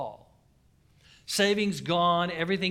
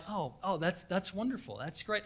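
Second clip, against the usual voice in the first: calm, polite reply, excitement held in; outwardly composed; 9 dB quieter than usual. Retold or imitated. Painter accepting the job from Bill, composed and polite. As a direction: keep it cool on the outside. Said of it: "So on the outside".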